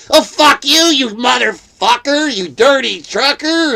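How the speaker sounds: loud, high-pitched caricature of her rage; mocking angry woman. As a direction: screech the insults as a hammed-up impression. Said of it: "she didn't like it".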